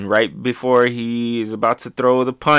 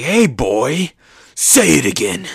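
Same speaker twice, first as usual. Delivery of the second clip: Kaido's taunt, a bit louder and higher; mock villain taunt; 3 semitones higher, 5 dB louder, slower than usual. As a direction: sneer it like the big bad boss.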